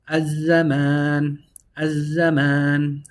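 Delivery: Az-Zaman stretched too long, slow; drawn-out Az-Zaman. Shown as a demonstration. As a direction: overextend Az-Zaman. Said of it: "I extended it too long".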